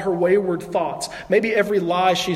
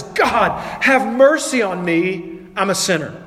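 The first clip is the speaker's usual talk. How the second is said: loud, higher, slow plea for mercy; crying out; 3 semitones higher, 4 dB louder, slower than usual. Acted. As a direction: cry out to God as a desperate sinner. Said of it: "He just cries out and says".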